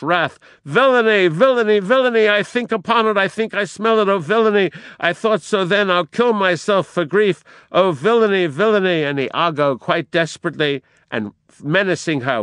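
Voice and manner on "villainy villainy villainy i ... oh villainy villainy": high, loud, raging repetition; furious outcry. Acted. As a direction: hurl it out in righteous wrath. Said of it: "utterly beside herself with fury".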